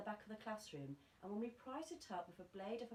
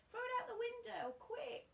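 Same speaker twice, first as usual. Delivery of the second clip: high, urgent schoolboy yell; urgent cry; 4 dB louder than usual. A narrator's reading. A boy urging the gang to throw the mouse out. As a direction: hurry them, loud and high.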